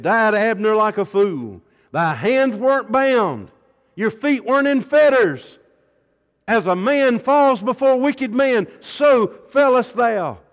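loud high wailing lament over the grave; lamenting king. A narrator's reading. King lamenting aloud over Abner's grave, loud grieving wail. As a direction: cry it out to the whole crowd in grief. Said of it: "the king lifted up his voice, and he wept".

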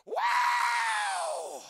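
huge high whooping holler; imitated hollering. Preacher imitating the man hollering in church, hollered whoop. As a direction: let out a long, piercing whoop. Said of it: "He's hollering".

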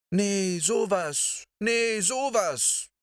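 high, slow amazed cry; astonished exclaiming. A narrator's reading. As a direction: clap hands and marvel aloud. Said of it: "Guste rief, die Hände zusammenschlagend".

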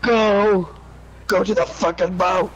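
ordinary talk, nothing marked

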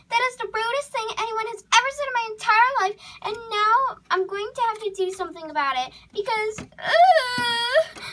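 hammed-up wail, high and rushed; tearful outrage. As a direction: play a wounded drama queen close to tears. Offended horse storms off after romantic rebuff.

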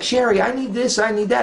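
high-pitched mock needy demands; Melissa.

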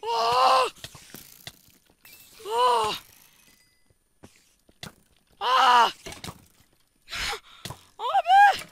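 very high, very loud screams; panicked screams. Real, not acted. Screaming as the mobs swarm him. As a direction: scream like the mob has you cornered. Screaming during game fight.

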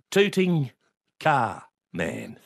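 word by word, spaced out; talking down. Retold or imitated. Father explaining nickname to son, talking down. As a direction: spell it out as if for an idiot. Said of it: "he spoke to me like I was an idiot".

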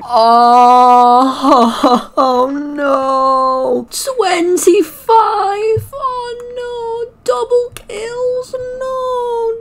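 high, whiny mock crying; fake-sob it, half laughing; hammed-up whimpering. Mock despair at double kills.

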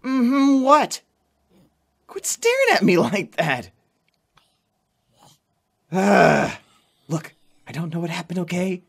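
high, loud embarrassed deflecting; flustered protest. Acted. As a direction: laugh it off, caught out and loud. Flustered, teased about crush.

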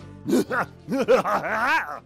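villainous cackle, swooping up and down; evil laugh. Shown as a demonstration.

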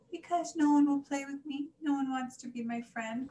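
high, plaintive little complaint; crying out. A narrator's reading. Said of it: "cried Katy".

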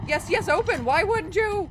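high, loud, frantic urging of the door; frantic excitement. Real, not acted. Gamer begging a stuck door to open, high frantic shout. Door finally opening while chased.

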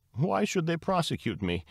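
high, plaintive mock sob; storybook whimper of protest; mock sobbing. A narrator's reading. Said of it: "Lily Bunny sobbed into the receiver".

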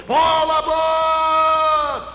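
long drawn-out high shout; loud call. Acted. Scout calling everyone aboard for Hollywood, loud drawn call. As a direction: stretch it out like a train conductor.

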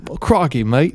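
hammy attempt at English, sliding Australian; badly faked English accent. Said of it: "I need to talk in an English accent".

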